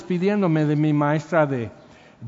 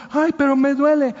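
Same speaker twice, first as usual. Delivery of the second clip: "Ay, pero me duele" in a much higher, louder whine; whiny complainer; 9 semitones higher, 4 dB louder than usual. Retold or imitated. Preacher mimics a church member who can't take correction, high whiny mock. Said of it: "mock complaining believer".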